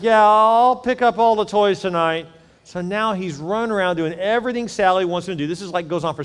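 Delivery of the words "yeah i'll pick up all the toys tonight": put-on higher, slower, reluctant kid voice; resigned boy. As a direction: give in like a beaten little boy. Defeated boy agreeing.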